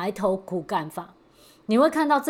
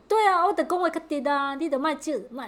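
put-on Taiwanese accent; 8 semitones higher than usual. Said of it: "哎，台语不会讲".

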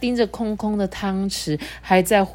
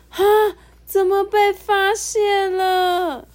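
much higher, slow startled cry; high slow surprised little voice; startled hedgehog; 12 semitones higher, 4 dB louder, slower than usual. A narrator's reading. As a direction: gasp out being caught.

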